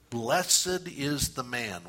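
very slow, drawn-out reading; slowly savoured. Shown as a demonstration. Preacher models soaking in a Bible verse, slowly savoured. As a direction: linger over each word of the verse. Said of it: "kind of slowly soak in it".

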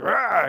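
short, loud mock roar of rage; angry roar. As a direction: growl out a Moses tantrum. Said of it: "he's so angry".

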